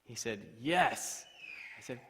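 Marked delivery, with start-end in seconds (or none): 0.6-1.2 loud, raised, emphatic confirmation; triumphant yes. Retold or imitated. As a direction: proclaim the good news in one word. Friend's triumphant one-word answer.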